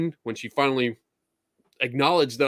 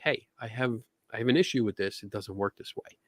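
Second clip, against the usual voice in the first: softer, just between two people; quiet private word; 2 semitones lower, 6 dB quieter than usual. Shown as a demonstration. Raising a problem privately with the guide, softly. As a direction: drop the voice so only she hears. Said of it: "I'm talking like this".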